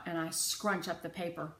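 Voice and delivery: ordinary delivery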